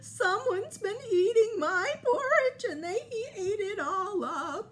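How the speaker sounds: high, loud sobbing little bear voice; crying baby bear. Acted. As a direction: wail it like a small crying cub. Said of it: "he started to cry".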